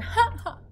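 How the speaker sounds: high, loud acted laugh; giant's hearty "ha ha"; bellow of laughter. A narrator's reading. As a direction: burst out in a storybook bellowing laugh. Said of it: "let out a bellow of a laughter".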